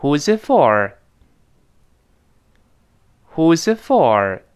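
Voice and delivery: "Who's it for?" dropping clearly at the end; teacher's read-aloud, "for" gliding down; final falling tune. Shown as a demonstration. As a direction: model the question with a firm fall on "for". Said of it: "注意最后降调".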